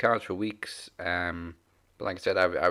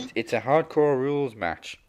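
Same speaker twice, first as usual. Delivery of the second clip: raised, louder acted reply; imitating Tommy; 6 semitones higher, 7 dB louder than usual. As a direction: answer loudly, playing the exasperated explainer. Acting out Tommy's answer to her.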